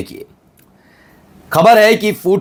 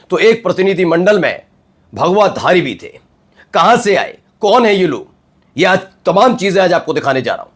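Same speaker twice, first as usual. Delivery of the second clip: higher-pitched, punchy TV anchor opener; dramatic news headlines; 4 semitones higher than usual. Acted. Anchor teasing the day's big stories to viewers, dramatic headline delivery. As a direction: announce the headlines in high, emphatic phrases with short pauses.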